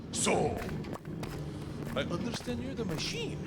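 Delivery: lightly voiced Russian cop; Russian accent. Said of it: "and he goes".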